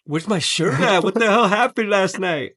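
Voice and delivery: high, bewildered questions; mock panic on waking up lost; confused, alarmed. Retelling confused morning.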